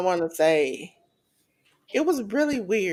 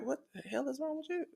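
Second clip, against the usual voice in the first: quick, hushed, higher disbelieving aside; incredulous stare; 5 semitones higher, 17 dB quieter, faster than usual. Retold or imitated. Seth and Roman staring at emotional Punk, quick hushed disbelief. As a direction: voice their baffled what's-wrong-with-you look.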